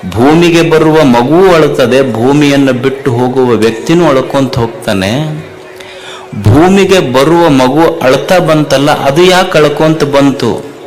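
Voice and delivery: loud, driving sermon cadence; fiery preaching. Acted. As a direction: preach it hard to the crowd. Preacher rising to full force. (ಜೋರಾಗಿ ಪ್ರವಚನ)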